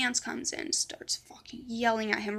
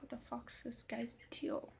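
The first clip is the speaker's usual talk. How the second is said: hushed aside; 12 dB quieter than usual. Retold or imitated. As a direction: mutter it under your breath to a friend. Hushed aside to Lisa after being scolded.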